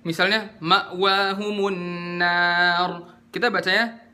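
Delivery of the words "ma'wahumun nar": slow chant, "Ma'wahumun" with a firm stop after Ma; clear hamzah sukun. Shown as a demonstration. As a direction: recite "Ma'wahumun nar" with a distinct glottal catch on the hamzah. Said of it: "Harus diperjelas di hamzah sukun ini".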